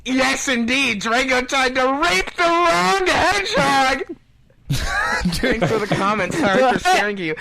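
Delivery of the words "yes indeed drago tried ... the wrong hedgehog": high, slow, relishing each word; gleeful gloat. Real, not acted. Reacting gleefully to a reader's comment, slow gloating. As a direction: savor it like a triumphant punchline.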